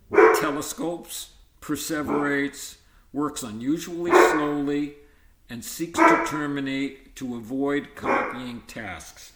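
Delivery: talking loudly over a barking dog. Real, not acted. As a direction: push the voice up to be heard over the noise. That's the dog. Gotta ignore her.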